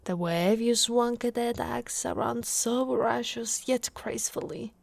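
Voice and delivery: usual speaking voice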